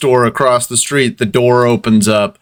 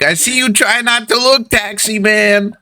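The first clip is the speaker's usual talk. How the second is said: put-on high kid voice confiding in a cabbie; sly paperboy child; 7 semitones higher than usual. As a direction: keep needling him in a piping newsboy voice. GM plays the paperboy again.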